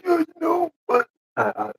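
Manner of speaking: put-on relapse into a high, loud old-man voice. Mark slipping back while apologizing, old man voice. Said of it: "you can't use your old man voice".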